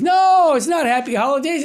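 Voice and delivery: loud, high no, then fast insistence; emphatic correction. Real, not acted. Host rejecting the holiday greeting, loud and firm. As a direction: shoot it down firmly. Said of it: "correcting the greeting".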